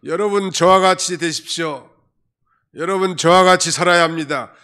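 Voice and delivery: a preacher loudly urging people to copy him; mock preacher's sermon. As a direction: proclaim it like a self-important preacher. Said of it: "매일 설교가".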